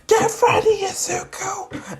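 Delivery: very high strained yell; high fighter's yell, tailing off in volume; acted battle cry. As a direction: charge in with a mock war cry. Bakugo charges at full force.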